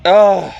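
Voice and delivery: loud exasperated ugh; loud disgusted grunt; frustrated groan. Real, not acted. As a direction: let out a fed-up groan. Exasperated at the lady's accusation.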